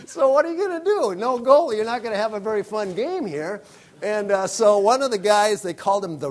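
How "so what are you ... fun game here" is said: higher, laughing through the question; amused at the absurd situation, giggling; laughing bystander's disbelief. Retold or imitated. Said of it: "And you're going".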